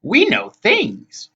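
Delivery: slow, high, knowing voice; boasting hag. A narrator's reading. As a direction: draw it out high and smug. Anger boasts of their wisdom.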